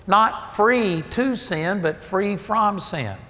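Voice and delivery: slow, pressing "to" then "from" hard; stress on to and from. Real, not acted. Preacher to congregation, contrasting free to sin with free from sin, slow and pointed. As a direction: lean on "to", then "from", slowly.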